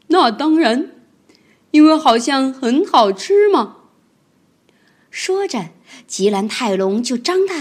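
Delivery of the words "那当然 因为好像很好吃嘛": 慢悠悠、阴险，"像"字上扬; 不怀好意地打量猎物"看起来好像很好吃"; 贪婪的吉兰泰龙. Acted. 吉兰泰龙回答. (慢而阴险地说这句话)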